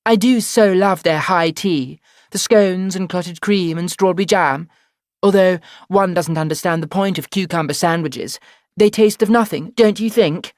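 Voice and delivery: put-on high, loud, affected upper-class English accent; posh voice. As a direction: play the grand English lady taking high tea. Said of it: "putting on my posh voice".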